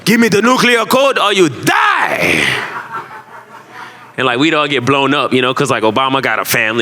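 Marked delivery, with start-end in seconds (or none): 0.0-2.5 villain voice. Pretend. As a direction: menace like a cartoon villain. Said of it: "I only got one villain voice".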